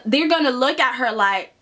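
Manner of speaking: ordinary talk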